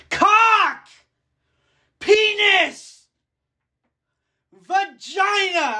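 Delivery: high, loud mock shouting of each word; retold yell. Podcaster retelling his public outbursts, shrill mock yells. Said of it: "just yelled".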